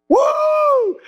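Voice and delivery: very high joyful woo; excited whoop. Real, not acted. Preacher whoops at the good news, very high and joyful. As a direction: let out a whoop of joy.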